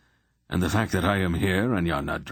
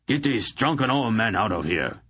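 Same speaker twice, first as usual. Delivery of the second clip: bawling order; 5 semitones higher than usual. A narrator's reading. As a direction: bark at the guards. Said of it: "he bawled".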